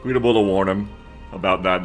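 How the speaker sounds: ordinary talk, nothing marked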